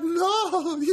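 very high, giggly imitation of Rosie; coy refusal. Imitating Rosie refusing a picture, squeaky and coy.

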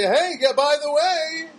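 high, chirpy ad pitch; mock upbeat sales voice; salesy marketer voice. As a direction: sell it like a pushy store mailer. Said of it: "so they can say".

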